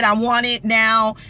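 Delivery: normal talking voice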